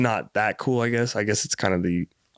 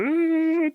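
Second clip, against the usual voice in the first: high frustrated groan. Retold or imitated. Guard eyeing him up.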